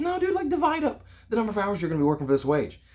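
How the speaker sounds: imitating dad's objection, pitched high; retold exasperated correction. Dad telling son to work out the wage, exasperated high correction.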